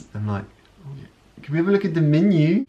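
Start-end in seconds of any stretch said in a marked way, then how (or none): 1.4-2.6 put-on high, loud Kiwi accent ordering at a restaurant; "menu" as "mɪnjʉ" with the vowels pushed forward Kiwi-style; New Zealand accent. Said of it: "a phrase that you can all learn in New Zealand".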